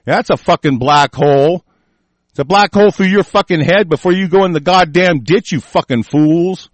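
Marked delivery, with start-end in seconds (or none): none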